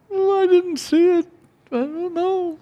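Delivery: very high, small voice of a frightened secretary; tearful secretary. Retold or imitated. Crying secretary answering her angry boss, high and timid. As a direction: pipe up meekly, close to tears.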